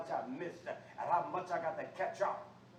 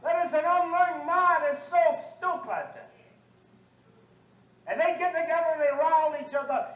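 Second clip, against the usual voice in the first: loud, high, scolding sermon; indignant preacher in full cry; fiery preaching; 6 semitones higher, 8 dB louder than usual. Real, not acted. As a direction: rail at the congregation's behavior.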